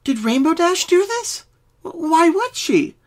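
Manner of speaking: high, slow, stammering doubt; worried Pinky. A narrator's reading. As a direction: puzzle over her friend, uneasy. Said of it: "Pinky asked, confused".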